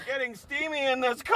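high, breathy-soft suggestive tease; teasing innuendo. Acted. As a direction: say it as a cheeky hint.

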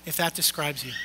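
softly repeating the altar call; quiet repeat. Shown as a demonstration. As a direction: let the invitation drop to a hush. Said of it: "if you just quietly raise your hand".

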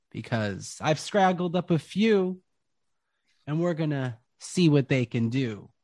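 loud, high showman barker; Jarrell the ringmaster. Acted. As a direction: hype the crowd like a carnival host. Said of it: "And you hear".

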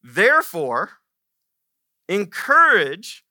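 "encourage" drawn out slow and loud; preacher landing the key word "encourage"; emphatic command. Acted. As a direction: lean hard into "encourage". Climax of the verse reading.

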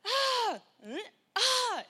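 high, loud mock toddler shrieks; toddler screams. Mother imitating her son's screams, high and loud. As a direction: squeal twice like a licked toddler.

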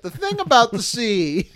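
high, loud, solemn author voice; grand literary narrator. Pretend. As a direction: intone it like an old novelist. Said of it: "I'm on my Hemingway shit".

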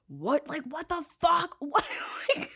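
normal talking voice

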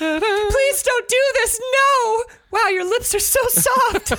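high, slow, squealing damsel, half-sung, a shouted no; hammed-up falsetto heroine. Podcaster parodies the heroine's change of heart, high and sing-song. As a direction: overplay a swooning seventies victim who melts. Mocking seventies scene heroine.

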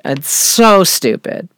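normal talking voice

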